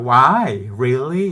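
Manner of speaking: loud, shocked disbelief. Retold or imitated. A salesman shocked at the crying concierge. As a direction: blurt it out in disbelief.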